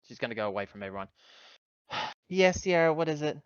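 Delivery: ordinary delivery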